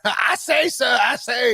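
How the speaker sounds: high, blustering cartoon Southerner; Foghorn Leghorn. Comparing the coach to a cartoon, blustering Southern impression. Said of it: "Foghorn Leghorn".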